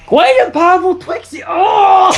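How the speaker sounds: high shouted boast; hammed-up high Twixie shout; mock Trixie shout. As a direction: yell it like a showman.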